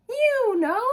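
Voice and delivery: ordinary delivery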